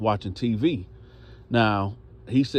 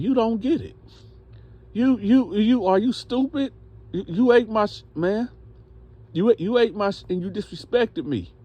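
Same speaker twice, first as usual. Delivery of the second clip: high-pitched, exasperated mock outrage; indignant confrontation; 11 semitones higher than usual. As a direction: stammer it out, pitched way up in disbelief. Acted angry confrontation by Larry.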